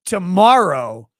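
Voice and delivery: loud, drawn-out announcement; loud slow teaser; big announcement. Real, not acted. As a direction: boom it out like a show host. Big announcement of tomorrow's topic.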